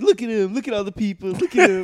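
high excited voice, amused; mock star pointing at fans; imitating [name]. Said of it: "And he's like".

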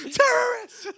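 very high, loud shriek; alarmed scream. Acted. Bystanders reacting to screamed Spanish, shrieking. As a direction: cry out the accusation in mock panic.

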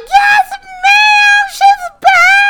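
very high, loud joyful shout; loud shrieking joy; excited scream. Retold or imitated. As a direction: scream with delight. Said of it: "I was like".

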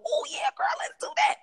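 put-on high, breathy eager "Oh yeah, girl"; playfully mock your own eagerness; boisterous self. Imitating her always-up-for-it self, high and eager. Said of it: "because I am so".

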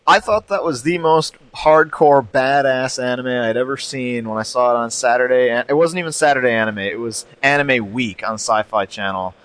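ordinary talk

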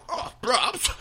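high-pitched mock British voice; thick British accent. Imitating Tom Hardy's British roles, thick British accent. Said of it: "the thick British accent".

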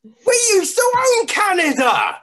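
very high, loud incredulous shout; mock disbelief. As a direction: exclaim in playful shock. Joking disbelief about snow.

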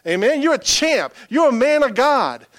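loud, high, fervent preacher; full force preaching. Acted. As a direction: pour it out like a revival sermon.

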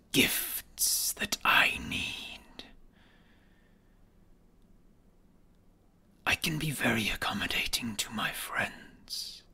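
slow sinister whispering villain; menacing whisper. Acted. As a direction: whisper each threat slowly, coldly. Villain dragon threatens and bargains.

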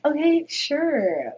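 slow, stretched okay sure; drawn-out casual agreement. Retold or imitated. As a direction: play it cool, drawn out. Drunk agreement.

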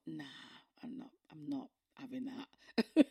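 a high unvoiced whisper, shrugging it off; whispered defiance. Telling how she brushed off the pastor, a high whispered refusal. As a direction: hush it, a breathy secret refusal. Retold defiant inner thought.

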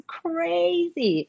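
"crazy" stretched very long; drawn-out emphasis. Real, not acted. Mother admitting how she yelled, "crazy" drawn out long. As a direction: linger on "crazy", slow and emphatic. Draws out word describing her yell.

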